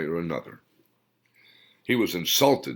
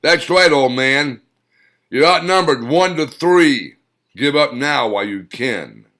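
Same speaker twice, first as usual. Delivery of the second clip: loud, high confident taunt; loud brash warrior taunt; Thor taunting; 5 semitones higher, 9 dB louder than usual. A narrator's reading. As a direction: lord it over the old man. Thor taunting the old man.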